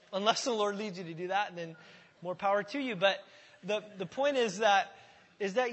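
normal talking voice

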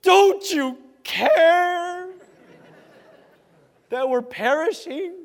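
high, very slow, pleading disciple; desperate plea. Retold or imitated. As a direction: beg him to care. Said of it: "this is my favorite".

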